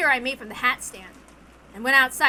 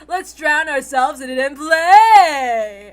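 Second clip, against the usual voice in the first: rowdy party call; 8 dB louder than usual. Acted. Witch urging Kinzo to drink up, loud and gleeful. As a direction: egg him on to drink and brawl.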